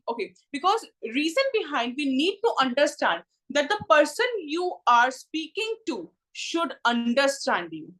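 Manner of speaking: ordinary talk, nothing marked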